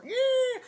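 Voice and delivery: very high, loud squealed yeah; joking high-pitched yelp; mock squeal. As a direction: let out a silly squeak. Joking about having no sponsor.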